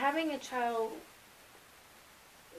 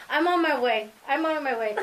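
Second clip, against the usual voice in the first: loud, high, laughing rush of joy; excited grandmother; 4 semitones higher, 10 dB louder than usual. Retold or imitated. Grandma on the phone, loud and overjoyed. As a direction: shout it through happy tears. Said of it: "My mom started crying too".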